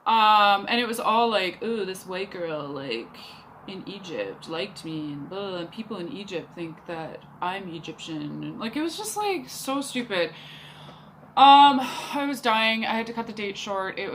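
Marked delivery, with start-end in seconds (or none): 1.6-8.4 low, dismissive mimicry; mock his boast in a lowered, softer voice; mocking quote. Retelling date's silly boast mockingly.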